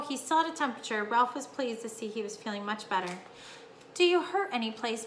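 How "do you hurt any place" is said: ordinary delivery, nothing marked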